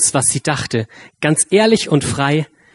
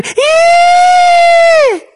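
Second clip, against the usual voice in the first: very high, loud, long squeal; squeaky lion roar; 10 dB louder than usual. A narrator's reading. Lion trying to roar at the mouse: shrill squeal. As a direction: roar with full fervor but out comes a squeak. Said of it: "brüllte der Löwe mit Inbrunst".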